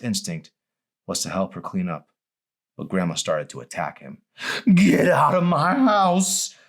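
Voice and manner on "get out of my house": put-on high, loud, slow old woman's order; demanding. A narrator's reading. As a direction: drive the intruder out of her house. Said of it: "she demanded".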